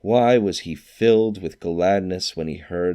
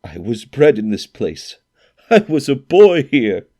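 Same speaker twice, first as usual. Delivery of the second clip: louder, higher, rising emotion; moved Scrooge; 7 semitones higher, 8 dB louder than usual. A narrator's reading. Scrooge tells the ghost of his boyhood home, overcome. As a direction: overcome with memory.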